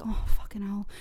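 whispered aside of dread; retold whispered exasperation; dismayed whisper. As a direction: curse under your breath. Retold dismayed private reaction to offer.

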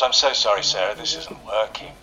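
normal talking voice

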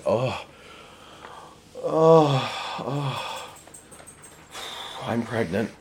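breathy swooning sighs rising; faint dramatically over him; hammed-up lust; mock swoon.

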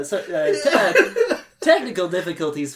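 loud, fast, cracking up; laughing. Real, not acted. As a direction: lose it while covering the flub. Host breaking up laughing.

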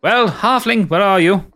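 high, loud imperious call; woman's voice. Acted. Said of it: "you hear a woman's voice show".

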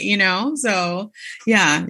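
normal talking voice

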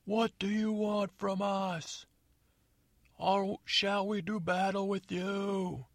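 high, slow pompous call from the walls; high slow theatrical yell; prince yelling down. Acted. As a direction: proclaim it like a hammy prince. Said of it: "he's yelling down".

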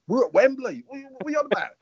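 high, disbelieving protest; a coach refusing a fight at Wembley, high and disbelieving; incredulous. Retold or imitated. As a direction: sound baffled at the absurd idea. Incredulous reply before the final.

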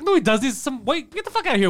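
high-pitched mocking rant. Retelling a show, high mocking rant. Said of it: "It's like".

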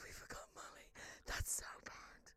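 sighed, whispered dismay; aghast whisper. Real, not acted. Guilty host to co-host, horrified whisper.

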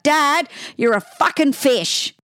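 high, drawn-out mock child's shout; little girl shouting out. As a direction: imitate a little girl calling out to Dad. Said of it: "Libby, shouted out".

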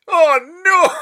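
high, loud, sobbing wail; pitiful wail. Pretend. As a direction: wail it like a crushed man. Acting a pitiful pitch.

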